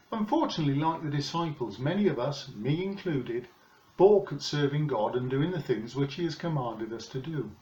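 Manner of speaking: normal talking voice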